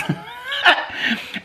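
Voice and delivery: high shrieking laugh that bursts out; burst of laughter. Real, not acted. Reading the pig's name, she shrieks with laughter. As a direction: crack up at the name.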